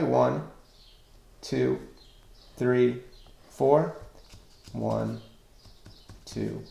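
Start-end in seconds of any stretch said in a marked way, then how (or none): none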